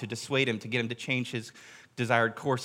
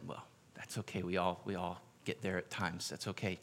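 hushed, gentle, near-whispered comfort; group gently consoling the gunman; soft reassurance; 5 semitones lower, 12 dB quieter than usual. Retold or imitated. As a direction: soothe him like a friend.

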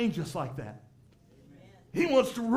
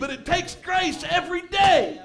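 louder, higher, shouting the sermon home; full-force preaching; 3 semitones higher, 5 dB louder than usual. Acted. Preacher to congregation, on the grace that keeps them: loud preaching shout. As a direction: drive it like a revival preacher at full cry.

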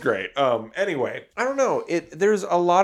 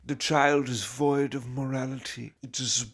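Werner Herzog impression; 4 dB quieter than usual. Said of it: "As Werner Herzog said".

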